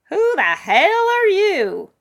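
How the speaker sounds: high, loud, stretched-out demand; shrill-pitched, slow indignation; indignant old woman. Acted. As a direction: challenge a stranger as a cranky old lady. Old woman challenges the stranger.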